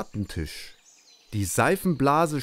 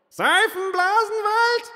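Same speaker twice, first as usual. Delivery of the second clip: indignant echo; slower than usual. Acted. Outraged beings echo the word.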